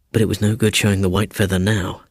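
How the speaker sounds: ordinary delivery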